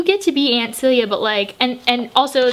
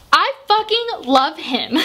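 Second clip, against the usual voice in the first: laughing love; 9 semitones higher, slower than usual. Real, not acted. Gushing about favourite character.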